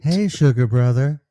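hearty loud hello; booming welcome to the show; loud greeting. Real, not acted. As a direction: call it out loud to your buddy.

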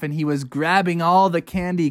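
ordinary delivery, nothing marked

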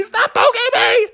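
very high whining plea; whiny cub. Acted. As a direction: whine at full height.

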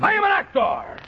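high, proud outburst; indignant shout. Acted. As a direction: declare it like an offended star. Indignant Santa protests to manager.

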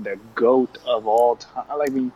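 ordinary talk

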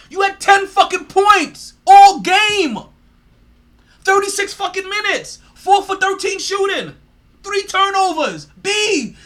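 loud, high, slow hammering stat list; furious rant. Real, not acted. Host yells the player's bad stats at him furiously. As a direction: spit each number out in disgust.

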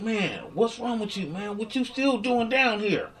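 high, slow questioning voice; crackhead voice. Retold or imitated. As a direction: voice the nosy stranger slowly. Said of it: "the dude was like".

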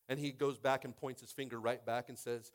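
ordinary talk

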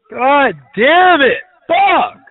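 slow, loud "God damn it" rising into a high roared curse; furious shout. Real, not acted. A drunk man hurt by the prank call, roaring in fury. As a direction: explode with real rage. Drunk caller erupts in rage.

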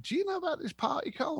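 high, quiet, quick feigned innocence; mock innocent question. Doorman teasing the guest by asking his mate, high and soft. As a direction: play dumb to your mate, light and high. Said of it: "I look at my fellow meathead and I went".

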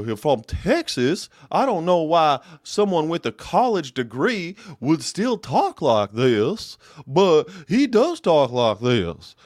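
slow, high, loud Texan drawl; mock Texan drawl. Mocking the TV host's Texas accent, slow and high. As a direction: stretch every word like a Texan TV host.

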